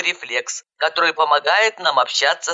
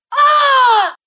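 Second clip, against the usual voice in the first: high, loud panicked scream; 6 dB louder than usual. A narrator's reading. Mother suddenly remembering her little boy, screaming. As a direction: shriek of sudden alarm. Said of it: "закричала я".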